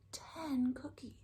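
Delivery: soft secret whisper. Acted. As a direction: whisper the number. Reading to kids, whispered count.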